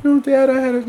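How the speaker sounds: lightly voiced high kid; a child pleading with dad; child's plea. Dad lightly imitating his child explaining something. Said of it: "something like".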